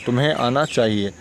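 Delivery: usual speaking voice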